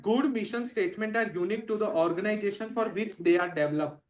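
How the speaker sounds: slow, even dictation read from the text. Acted. Teacher dictating notes to the class, very slow and even. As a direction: read it out word by word so students can write.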